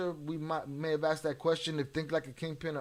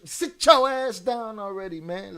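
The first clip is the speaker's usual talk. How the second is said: scolding shout; 7 semitones higher, 11 dB louder than usual. Real, not acted. Scolding Lala on the show.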